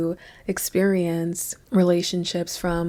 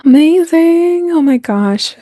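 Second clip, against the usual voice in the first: excited; 11 semitones higher, 11 dB louder than usual. Real, not acted. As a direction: exclaim it big and high, easing down. Excited greeting to live group.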